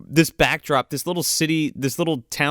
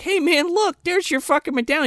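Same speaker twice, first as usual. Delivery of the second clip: mock Russell; 11 semitones higher than usual. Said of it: "Russell's like".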